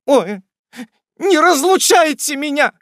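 high, loud melodramatic plea; heart-rending cry. A narrator's reading. The spinster aunt begs not to be parted, heart-rending. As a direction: wail it out in distress. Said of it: "воскликнула незамужняя тётушка раздиратель".

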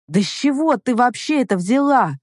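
exasperated, high-pitched question; impatient, incredulous rise in pitch; losing patience. A narrator's reading. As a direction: ask it as if patience is running out. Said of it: "теряя терпение".

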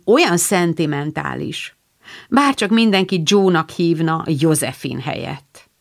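usual speaking voice

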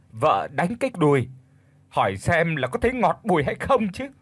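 high-pitched, aggrieved village wife; wailing lament. Acted. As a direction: cry out your loss to the heavens, indignant. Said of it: "mụ giơ hai tay lên than trời".

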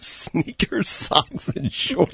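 breathy, laughing disbelief; mock the outfit item by item; incredulous mockery. Cohost repeats the guest's steam-room outfit, incredulous mockery.

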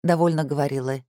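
ordinary delivery

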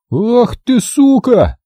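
high-pitched, furious yell; yelled curse. A narrator's reading. Max curses the shooter while starting the tractor, yelling. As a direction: yell it at the top of the range. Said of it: "заорал Макс".

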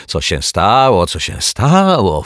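loud, drawn-out mocking echo; huffy snapping. A narrator's reading. Neighbour throwing the detective's question back, huffy. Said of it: "fuknęła pani Majewska".

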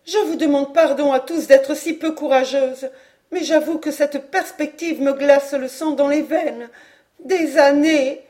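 high, loud despairing lament; appalled. A narrator's reading. Mother believes she will never see France, appalled. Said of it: "répétait madame Caudal atterrée".

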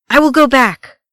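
loud, high cry of rage; enraged cry. A narrator's reading. Gimli insists on returning for the fallen, enraged. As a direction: cry out in furious defiance. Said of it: "cried, rage contorting his face".

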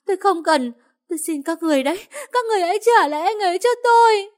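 hoarse, very high sobbing scream; woman held back by police, screaming hoarsely through sobs; hoarse crying wail. Acted. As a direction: howl like a caged animal, crying as she begs. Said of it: "tiếng gào khản đặc như con thú bị giam cầm, vừa khóc vừa nói".

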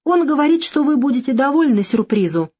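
high-pitched, flustered little voice; flustered squeak. A narrator's reading. Secretary relaying a caller's message to her boss, flustered squeak. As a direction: squeak it out, lost and close to tears. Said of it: "растерянно пропищала она".

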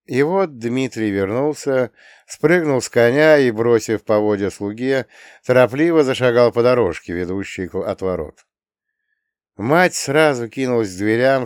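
ordinary delivery, nothing marked

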